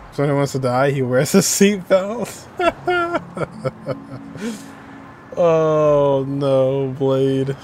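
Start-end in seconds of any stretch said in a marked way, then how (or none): 2.5-5.3 high, breathy burst of laughter. Real, not acted. Streamer cracking up at Blade's seatbelt joke, high laugh. 5.4-7.6 loud amused oh no; amused groan. Real, not acted. Streamer groaning at Blade's dark joke, amused and loud.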